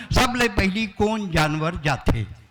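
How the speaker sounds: ordinary talk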